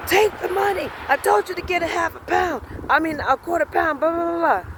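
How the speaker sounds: high-pitched mock of an irate man; mock yelling. Man ordering her to take the money, mock yelling. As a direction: imitate his demand without full volume.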